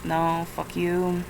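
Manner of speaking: ordinary talk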